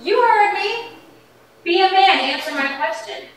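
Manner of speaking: angry challenge. Acted. Wife daring her husband to answer, angry. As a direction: throw it at him as a dare.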